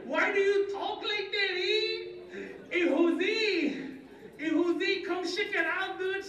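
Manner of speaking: put-on very high-pitched Mexican-accented kid; Mexican schoolkid. Classmate mocking how new boy talks, high Mexican kid voice. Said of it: "this other kid comes up".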